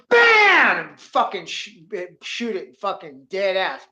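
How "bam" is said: loud, high shouted bang; imitated gunshot. Storyteller drawing on a balloon, explosive gunshot noise. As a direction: fire the shot with your voice.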